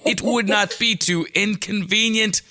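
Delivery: high, measured, painstakingly polite; over-enunciate each phrase in tense Malkovich style; that stilted voice. Acted. Pressing Dad to lunch, stiffly courteous. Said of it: "say it in that voice".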